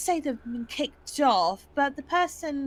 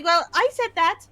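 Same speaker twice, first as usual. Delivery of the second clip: character retort; 4 semitones higher, 7 dB louder than usual. Pretend. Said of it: "she's gonna say".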